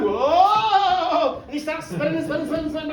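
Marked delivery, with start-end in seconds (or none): 0.0-1.4 raised, loud, drawn-out cry; long airborne whoa; alarmed whoa. Acted. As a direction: wail it as you lose footing. Hamish floating in the air.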